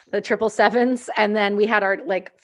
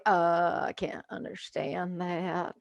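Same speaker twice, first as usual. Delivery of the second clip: low, slow, much softer confused voice; mock baffled American; 3 semitones lower, 8 dB quieter, much slower than usual. Texans hearing French on the radio, mock confusion. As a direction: play them lost and baffled. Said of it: "they're like".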